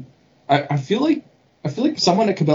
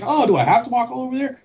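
much higher, playful mock complaint; jovial teasing; 10 semitones higher, faster than usual. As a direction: imitate a clerk griping in jest. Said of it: "a jovial".

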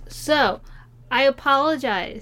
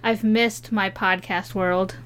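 ordinary talk

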